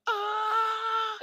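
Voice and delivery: very high shout; high yell. Acted.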